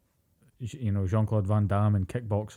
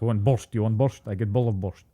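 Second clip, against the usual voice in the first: broken English, mock Russian accent; 3 dB louder than usual. Playing a Russian offering borscht, mock Russian accent. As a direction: offer food as a hammed-up Russian. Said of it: "was a Russian accent".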